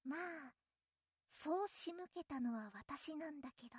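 muttered to herself, very soft; sly aside. Acted. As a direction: let the scheme slip under your breath. Sly aside after drugging listener.

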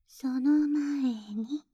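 slow whisper. Acted. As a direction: whisper it, drawn out. (ゆっくりささやく)

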